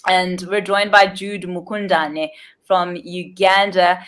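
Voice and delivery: ordinary talk, nothing marked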